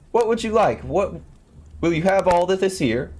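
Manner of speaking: high, loud little voice calling out; tiny boy crying out. A narrator's reading. Tom Thumb teasing the thieves, loud high call. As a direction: call out in a high, piping boy's voice. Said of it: "cried out again".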